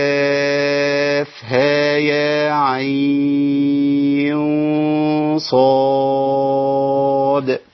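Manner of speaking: very slow chant, عين held four counts; long madd on عين. Shown as a demonstration. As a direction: intone the letters, stretching كاف, عين and صاد. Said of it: "عندي هنا أربعة وستة". (ترتيل بمد عين مدا طويلا)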